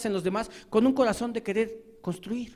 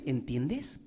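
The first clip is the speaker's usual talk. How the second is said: "¿Entiendes?" much lower and softer, rising; low quiet echo; 7 semitones lower, 9 dB quieter than usual. Acted. Preacher turning the question on the accuser, softly. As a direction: hand the question back quietly.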